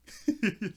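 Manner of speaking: high loud laugh breaking out; real amused laugh; burst of laughter. Real, not acted. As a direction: crack up laughing. Laughing at own retelling.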